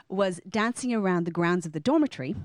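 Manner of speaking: ordinary talk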